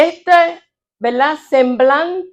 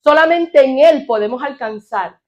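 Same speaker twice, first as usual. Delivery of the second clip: fervent preaching; 2 semitones higher, 5 dB louder than usual. Acted. Pastor exhorting his church, preaching at full force. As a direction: drive the point home to the congregation.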